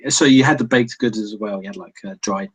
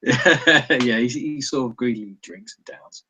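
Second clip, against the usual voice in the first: normal talking voice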